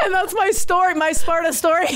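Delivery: high, laughing wrap-up. Real, not acted. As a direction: finish the story cracking up. Cracks up ending her story.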